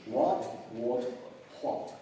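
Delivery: "what" said three ways, one by one; three pronunciation variants. Shown as a demonstration. Teacher recapping three possible pronunciations for students, pronunciation variants. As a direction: slowly contrast "what" with w, rounded vowel, then h onset. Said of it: "Caben esas tres posibilidades".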